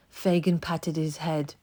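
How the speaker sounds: ordinary delivery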